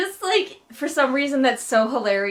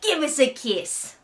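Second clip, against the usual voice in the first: put-on silly, higher-pitched Tobias accent; 9 semitones higher, 4 dB louder than usual. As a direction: declare love in a hammed-up Tobias accent. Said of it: "with my silly Tobias accent".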